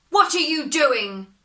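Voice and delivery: high, loud startled demand; angry outcry. Acted. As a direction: snap at her in pain. Devil cries out.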